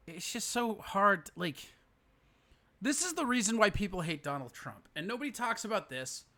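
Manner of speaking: normal talking voice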